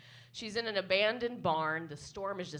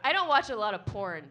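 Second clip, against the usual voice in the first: ordinary delivery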